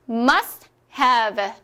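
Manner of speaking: "must have" as "MAS hav", silent T; T-dropped English pronunciation. Shown as a demonstration. Teacher models after removing the T, English pronunciation. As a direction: model "must have" in two beats, leaving out T. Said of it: "T를 한번 빼줄게요".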